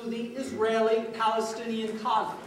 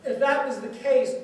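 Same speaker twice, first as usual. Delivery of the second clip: ordinary talk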